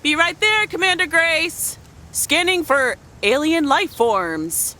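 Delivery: high, loud astronaut game voice; playful calling back. Pretend. Mother to her commander while scanning for aliens, loud playful call. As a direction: call back in character, slowing grandly at the end.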